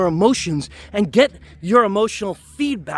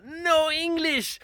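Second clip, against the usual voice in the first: put-on slow, accented, a bit higher and softer; grandmother's broken English; 7 semitones higher, 5 dB quieter, slower than usual. Grandmother shooing people away, in a slow, softer foreign accent. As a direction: feign not understanding, slowly. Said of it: "she would go".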